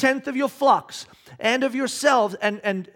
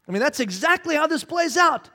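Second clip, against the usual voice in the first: ordinary talk, nothing marked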